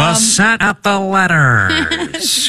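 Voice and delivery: very high, slow drawn-out radio call; old-timey radio voice. Acted. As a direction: stretch the words like a vintage announcer. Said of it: "I'm gonna do an old-timey radio voice".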